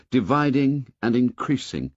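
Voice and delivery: usual speaking voice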